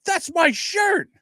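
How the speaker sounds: slow, high-pitched indignant cry; mock outrage. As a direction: imitate a cousin wailing in protest. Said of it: "him and I are nose to nose spitting".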